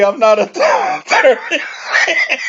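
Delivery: real giggling disclaimer; laughing. Real, not acted. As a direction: crack up midway through the aside. Laughs at own aside.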